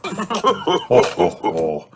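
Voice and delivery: jolly dad's ho-ho laugh; pleased, hearty acted chuckle; chuckling. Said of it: "chuckles Daddy Pig".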